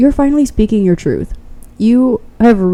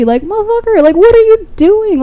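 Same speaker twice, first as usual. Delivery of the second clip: exasperated; 11 semitones higher than usual. Real, not acted. Exasperated rant at pile's tormentors.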